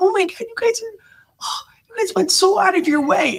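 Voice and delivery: very high, thrilled squeal of thanks; delighted guest. Retold or imitated. Guests thanking the host for dessert, high squeal. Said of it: "And they're like".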